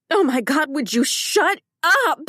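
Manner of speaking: furious scream down the phone. Acted. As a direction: scream it at him to shut up. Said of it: "This time I scream into the phone".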